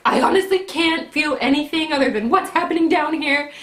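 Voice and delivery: high, loud mad retort; retold exasperation. Labouring woman answers nurse doing IV prep, high exasperated retort. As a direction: re-enact snapping back, fed up. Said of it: "I was just so mad".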